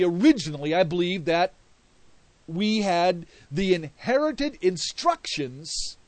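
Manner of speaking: loud, rising preacher's cadence; fervent sermon delivery with loud swells; preaching. Acted. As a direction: preach it hard to the congregation. Preaching at full force.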